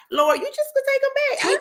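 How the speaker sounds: mock plea to God. Host mimicking her own prayer to give the gifts back, whining. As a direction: beg like a tired child. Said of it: "that you like".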